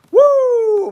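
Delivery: drawn-out falsetto "woo"; high loud whoop. Real, not acted. Host reacting to an absolute disaster, high whoop.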